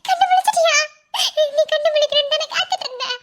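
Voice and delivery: high, crying joyful question; tearful relief. Acted. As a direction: sob with relief as he wakes. Wife sees husband wake. (நிம்மதி அழுகையுடன்)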